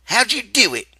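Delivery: high, loud demanding question; Carlson's voice. A narrator's reading. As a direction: press him hard for an answer.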